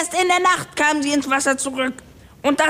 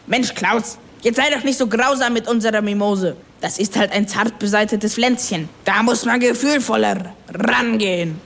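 a much lower, soothing sailor's voice; gentle sailor Sven; 7 semitones lower than usual. Acted. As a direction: coax the crewmate kindly in a low voice. Sven's voiced lines. (Sven beschwichtigt Klaus mit tiefer, sanfter Stimme)

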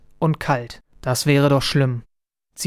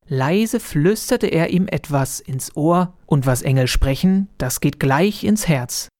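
usual speaking voice

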